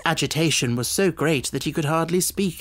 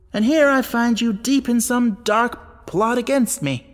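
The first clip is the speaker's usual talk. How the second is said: louder, rising indignation; angry baronet; 5 semitones higher, 3 dB louder than usual. A narrator's reading. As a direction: shame him out the door. Baronet dismisses butler in anger.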